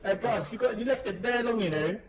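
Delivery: put-on high, nasally London street accent; nasally London slang. Fridge telling a bruv off, nasally London slang accent. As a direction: pitch it up and through the nose, London slang. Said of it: "It's kinda nasally".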